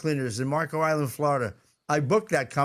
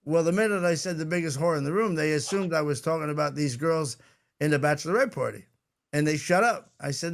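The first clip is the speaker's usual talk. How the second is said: usual speaking voice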